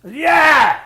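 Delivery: high, loud yell back; shouted answer. Retold or imitated. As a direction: holler up that you're alive. Answering from the ditch.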